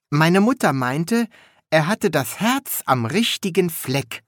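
high, chatty apprentice voice; high-pitched young apprentice. A narrator's reading. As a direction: prattle it high and innocently.

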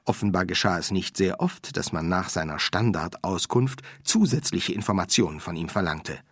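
ordinary delivery, nothing marked

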